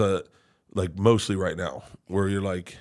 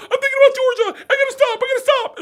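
very high, loud, tearful wail; retold crying fit; 9 dB louder than usual. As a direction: re-enact being overwhelmed by tears. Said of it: "I can't stop crying".

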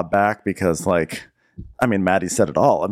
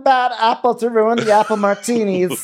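mock T J. Cohost riffs as T J, high mock moralizing. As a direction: preach it like an offended bar loyalist.

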